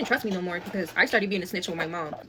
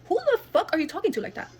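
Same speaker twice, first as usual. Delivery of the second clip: higher, sharp comeback; indignant retort; 8 semitones higher, faster than usual. Retold or imitated. Teen narrator snapping back at the cousin, indignant. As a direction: fire it back at her.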